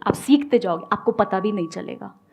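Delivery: ordinary delivery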